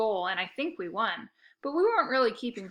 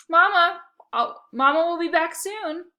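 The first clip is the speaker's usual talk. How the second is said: high, loud doting coo to the dog; baby-talk coo to her poodle; 8 semitones higher, 9 dB louder than usual. Acted. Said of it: "she cooed".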